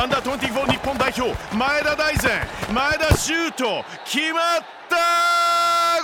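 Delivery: building play-by-play, climbing to a shout; breathless rising goal commentary. Pretend. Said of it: "妄想バーチャル実況". (絶叫へ高まっていくゴール実況)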